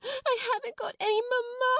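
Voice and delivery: put-on very high, howled child voice; wailing small child. A narrator's reading. Lottie demanding pity in a tantrum, wailing. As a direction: wail it, stretching the last word. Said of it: "Lottie howled tempestuously".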